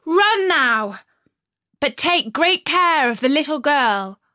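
high, loud urgent command; robber girl voice. Acted. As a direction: send the reindeer off urgently. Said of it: "said to the reindeer".

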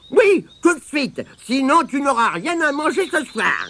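put-on high, insistent old woman's threat; piercing granny voice. A narrator's reading. Mémé Croche threatening Tirondin with no supper, piercing. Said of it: "la voix perçante de Mémé Croche".

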